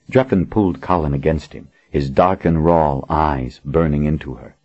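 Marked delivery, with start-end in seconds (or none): none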